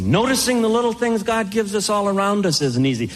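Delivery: ordinary talk, nothing marked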